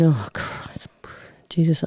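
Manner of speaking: hushed, breathy exasperation; half-whispered oath under the breath; retold dismay. Retelling dismayed reaction to mother's lie.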